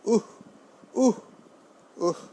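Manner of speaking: "উহ" as a short burst with a half h; half-h ending. Shown as a demonstration. Teacher drilling visarga, "উহ" short with a half-h ending. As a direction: cut each "উহ" off on a puff of breath. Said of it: "হ হবে".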